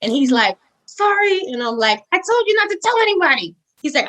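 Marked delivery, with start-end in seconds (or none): 0.8-1.4 small high-pitched imitated apology; sheepish husband. Husband caught spilling the secret, high sheepish imitation. 2.1-3.5 high, quick retold telling-off; mock scolding. Wife scolds husband over the secret, high mock telling-off.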